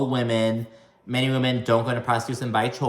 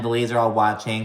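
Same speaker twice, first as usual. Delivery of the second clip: normal talking voice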